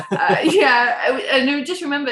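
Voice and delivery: laughing through the word, voice rising; a real laugh breaking in; a tearful laugh. Real, not acted. Laughing while recalling embarrassment.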